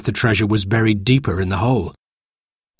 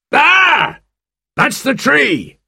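very high, drawn-out cry of discovery; excited shout; 4 dB louder, much slower than usual. A narrator's reading. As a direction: yell it out to the whole crew. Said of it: "he shouted".

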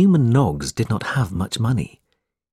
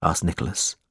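ordinary delivery, nothing marked

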